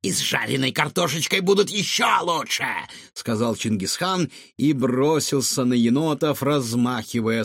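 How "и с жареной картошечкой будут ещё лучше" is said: higher-pitched, relishing retort; gloating villain. A narrator's reading. Cook retorts to girl defending raccoons, gloating.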